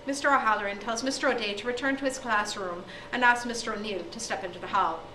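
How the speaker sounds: plain reading voice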